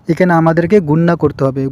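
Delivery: ordinary talk, nothing marked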